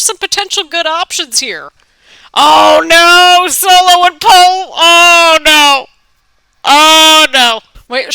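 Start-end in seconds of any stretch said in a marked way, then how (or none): 2.3-7.6 loud shocked oh no; shocked shouting. Real, not acted. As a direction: yell it out in alarm. Reacting to Solo and Paul.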